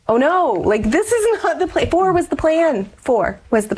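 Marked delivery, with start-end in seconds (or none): 0.1-1.9 retelling a shocked "oh no" on hearing the news; dismayed surprise. Retold or imitated. Mother learning she is pregnant again, alarmed and much higher. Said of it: "And I went". 1.9-2.9 much higher, insisting on the number; emphatic, high. Real, not acted. Mother insisting to the host they were done, much higher. Repeats her point twice.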